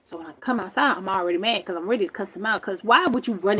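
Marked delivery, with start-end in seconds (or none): none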